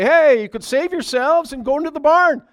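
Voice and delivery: high, loud cheerful invitation; man imagining chirping to the birds; eager call. Pretend. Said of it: "chirp them into the barn and say".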